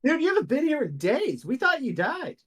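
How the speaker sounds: guard stunned the missing man is back; astonished guard. Acted. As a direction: blurt it out, stunned. Guard shocked at Langham.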